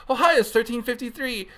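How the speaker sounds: high, bubbly window greeting; peppy, high service voice; happy drive-through worker. Acted. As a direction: send them off with chirpy cheer. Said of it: "like, a happy person".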